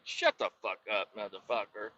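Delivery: softly spoken mock insult; quiet put-down. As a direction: brush him off under your breath. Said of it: "I'm like".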